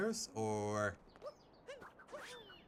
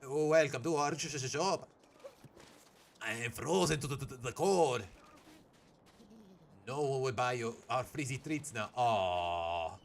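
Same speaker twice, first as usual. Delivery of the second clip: stuttering cartoon Mexican accent; Speedy Gonzales; slower than usual. Streamer voicing a frozen shopkeeper, Speedy Gonzales accent. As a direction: put on a cartoon Mexican accent, stammering from cold. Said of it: "give them all a Speedy Gonzales accent".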